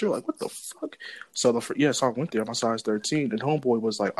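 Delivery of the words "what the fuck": shocked. Retold or imitated. Shocked at a seven hundred price.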